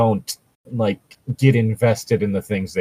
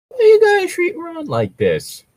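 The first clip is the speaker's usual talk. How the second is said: high-pitched, hammed-up whine of protest; mock outrage; 4 dB louder than usual. Pleading with friends who mock Ron, high mock whine. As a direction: wail it like an offended fan defending a friend.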